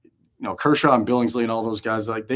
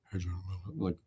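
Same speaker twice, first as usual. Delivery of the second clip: barely audible, much softer mumble; very low voice; 3 semitones lower, 13 dB quieter than usual. Retold or imitated. Soft-spoken prankster calling to John, barely audible. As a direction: talk so low he can't be heard. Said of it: "he would talk so low".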